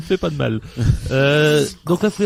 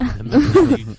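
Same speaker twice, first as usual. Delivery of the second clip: high squeaky; 11 semitones higher, 6 dB louder than usual. Pretend. Joking about a high-pitched sound.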